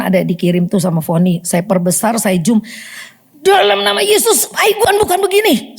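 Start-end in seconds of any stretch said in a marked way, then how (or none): 3.4-5.8 high, commanding rebuke in Jesus' name; retold angry rebuke of the photo; angry rebuking prayer. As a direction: command it sharply, pitched high. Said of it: "Saya marah dengan fotonya".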